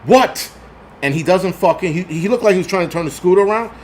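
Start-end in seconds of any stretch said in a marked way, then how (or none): 0.0-0.5 high, sharp mock yell; retold yell. Driver yelling out the window at a staring rider, retold shout. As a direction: call it out like yelling from a car. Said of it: "I'm yelling out the fucking window".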